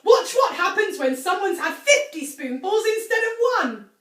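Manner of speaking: gleeful. A narrator's reading. Gleeful Mr Cranky hops about.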